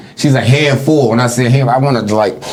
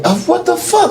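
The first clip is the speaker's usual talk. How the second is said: much higher, disbelieving what the fuck; baffled outburst. Real, not acted. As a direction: cry out in real disbelief at the rule. Baffled by wife's bedtime rule.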